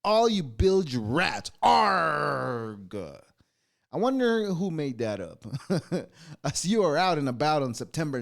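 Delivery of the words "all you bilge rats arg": slow, hammed-up pirate call. Host calling listeners bilge rats in a hammed-up pirate voice. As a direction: hail the crew like a captain. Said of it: "talk like pirates".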